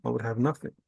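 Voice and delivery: ordinary talk, nothing marked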